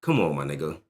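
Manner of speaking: ordinary talk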